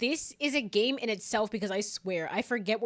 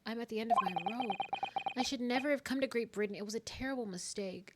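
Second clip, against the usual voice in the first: soft, subdued despair; despairing game character; 6 dB quieter than usual. Acted. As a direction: quietly give up, worn down. Reads despairing character's lines.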